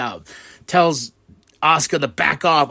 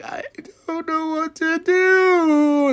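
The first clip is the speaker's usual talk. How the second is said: very high, slow, drawn-out whine; hammed-up helpless squeal; helpless wail; 4 dB louder, much slower than usual. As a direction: wail it in a squeaky mock falsetto. Imitates helpless Kairi Sane.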